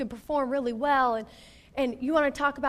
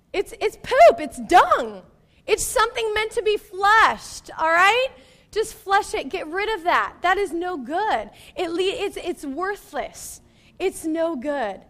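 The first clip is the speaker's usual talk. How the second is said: high and loud emphatic preaching; 6 semitones higher, 7 dB louder, slower than usual. Real, not acted. Preacher urging the church to flush it, loud. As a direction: hammer it home to the congregation.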